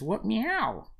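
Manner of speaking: high kitty voice, meow swooping up; talking cat. Acted. Cat answering the player, high and meowy. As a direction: ask it as a little cat. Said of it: "The cat says".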